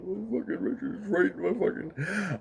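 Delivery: high-pitched put-on crying voice; mock crying. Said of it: "he's crying. He's going".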